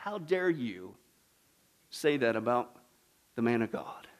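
the bear scolds the mockers in a low hush; hushed bear's rebuke. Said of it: "And he's like".

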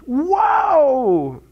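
high loud cry of joy; joyful shout; excited whoa. Real, not acted. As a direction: let out a happy whoop. Exclaiming after quoting psalm.

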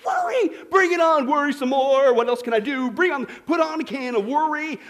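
loud sarcastic glee; sarcastic eager worrier. Retold or imitated. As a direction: ham up someone thrilled to worry. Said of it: "How many guys ... go".

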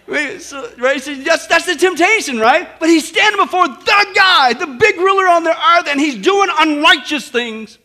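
loud, high, building refrain with shouts; full-force preaching. Real, not acted. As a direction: thunder it over the congregation.

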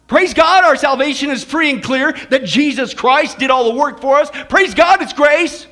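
hammed-up, defensive praise-God attitude; dismissive churchgoer. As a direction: brush it off as a self-excusing churchgoer. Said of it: "what's our attitude in the church".

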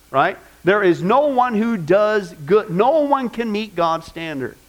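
normal talking voice

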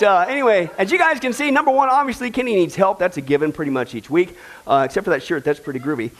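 normal talking voice

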